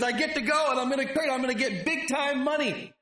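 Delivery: fast and high, gloating about money; greedy glee. Retold or imitated. Balaam gloating to himself about the money, fast excited. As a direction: rattle it off like a man who smells cash.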